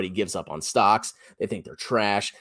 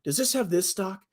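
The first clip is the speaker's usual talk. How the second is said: anxious investor; 8 semitones higher than usual. Retold or imitated. Mocking viewers to his audience, pitched-up fretful investor voice. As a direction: play the nervous newbie checking every holding. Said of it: "So you're like".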